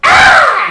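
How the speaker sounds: very high, loud delighted squeal; creature's joyful shriek. Acted. Little floating creature squealing with joy at the dance, high loud squeal. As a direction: squeal with glee as it spins. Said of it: "he just".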